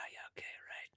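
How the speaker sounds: whispered to herself. Retold or imitated. Musher conceding to herself, whispered. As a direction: breathe it out in a whisper, giving in. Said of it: "I'm like".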